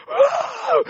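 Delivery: high loud gasp of realization; sudden cry. Retold or imitated. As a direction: cry out as it hits her. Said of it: "And she went".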